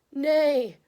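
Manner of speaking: a high, loud whinnying horse; hammed-up farmyard neigh. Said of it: "said the horse".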